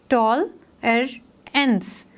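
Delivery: slow "टॉल, एर, एंस" with a break after each part; split into syllables. Shown as a demonstration. Teacher sounding out tolerance for pupils, syllable by syllable. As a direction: say "टॉल एर एंस" as three separate beats. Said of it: "हम इसको बोल के देखते हैं".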